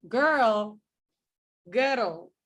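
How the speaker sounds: put-on "girl" with an overdone tapped r, like "kut-el"; exaggerated Scottish r. Shown as a demonstration. Teacher overdoes the Scottish "girl" on purpose. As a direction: roll the r in "girl" far too much. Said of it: "not as noticeable as I'm making it".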